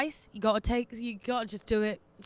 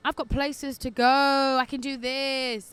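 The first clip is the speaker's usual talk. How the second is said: slow, loud, smug boast; mock smug boast; 7 dB louder, slower than usual. Single friend gloating at married siblings, slow and loud. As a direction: gloat like you're the free one.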